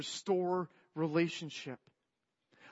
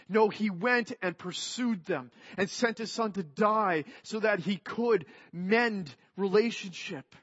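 louder, higher, swelling preacher's cry; full-force preaching; 4 semitones higher, 5 dB louder than usual. Acted. Preacher urging his congregation on God's love, building to full force. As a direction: drive the gospel home to the congregation.

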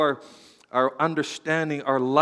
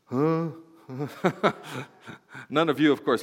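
usual speaking voice

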